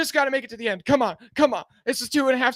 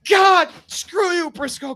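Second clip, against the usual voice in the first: loud, high, slow curse; angry outburst; 7 semitones higher, 6 dB louder, slower than usual. Real, not acted. Streamer cursing Briscoe for passing, loud and slow. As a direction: spit it at the rival driver. Rival passes his driver.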